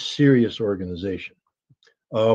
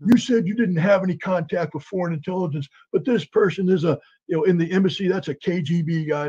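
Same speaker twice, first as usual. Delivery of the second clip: raised, higher accusing voice; hostile interrogator; 6 semitones higher than usual. Retold or imitated. Agent accusing Marina of KGB contact, raised accusing voice. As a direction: press her like a bullying agent.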